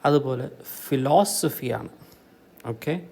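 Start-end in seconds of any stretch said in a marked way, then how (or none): 0.9-1.9 ഫിലോസഫിയാണ് with weight on LOS; stress on LOS. Shown as a demonstration. Teacher showing the correct form, LOS stressed in ഫിലോസഫിയാണ്. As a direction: lean on the second syllable of ഫിലോസഫിയാണ്. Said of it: "that is correct".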